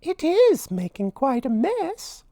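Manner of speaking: very high, dainty falsetto; tiny amused heroine; smiling Minnie. A narrator's reading. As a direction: exclaim sweetly with a smile. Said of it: "said Minnie with a smile".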